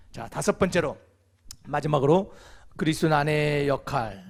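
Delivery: ordinary talk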